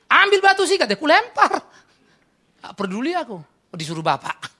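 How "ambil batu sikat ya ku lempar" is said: high, loud, worked-up boasting; excited retelling. Retold or imitated. Boy re-enacts grabbing a stone to throw, fired up. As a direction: play the fired-up kid, voice pushed up.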